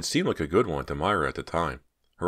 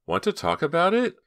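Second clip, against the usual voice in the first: high questioning; 13 semitones higher, 4 dB louder than usual. A narrator's reading. Donna asks gently.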